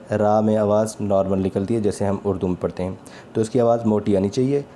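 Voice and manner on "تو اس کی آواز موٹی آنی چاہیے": ordinary delivery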